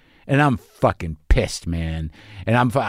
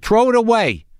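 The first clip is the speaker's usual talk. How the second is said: put-on higher-pitched Queens accent; 8 semitones higher than usual. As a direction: demonstrate a punchy Queens accent. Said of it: "from Queens".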